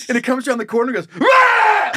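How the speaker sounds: sudden high, loud "Rah!" jump scare; loud scare roar. Retold or imitated. Host acting out the actor scaring a chihuahua, loud roar. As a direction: burst out like a haunted-house monster. Imitating haunted house scare.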